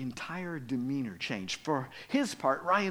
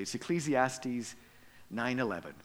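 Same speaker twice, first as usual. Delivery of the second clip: normal talking voice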